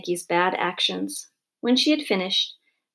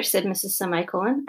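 plain reading voice

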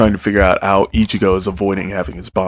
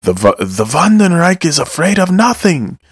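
high, pitched-up mock yell; mock scream; 10 semitones higher than usual. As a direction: squeal it like a villain losing it. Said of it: "tunes up and screams".

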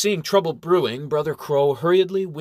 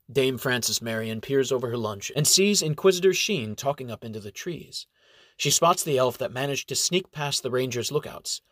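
ordinary delivery

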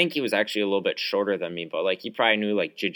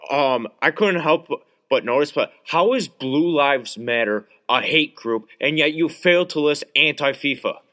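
mocking know-it-all; 5 semitones higher, 4 dB louder than usual. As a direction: imitate the smug kid drawing out his challenge. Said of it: "and is like".

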